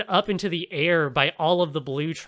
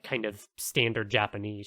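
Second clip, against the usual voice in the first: usual speaking voice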